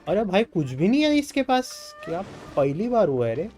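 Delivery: loud, high-pitched incredulous complaint; exasperated disbelief. Real, not acted. Player grumbles at shop having nothing, exasperated disbelief. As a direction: protest with big swooping pitch, in disbelief.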